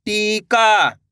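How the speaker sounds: टीका with a long, held ee; टीका, held long for learners; drawn-out long vowel. Shown as a demonstration. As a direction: sound out टीका in stretched syllables. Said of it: "तो आपको खींच के पढ़ना है".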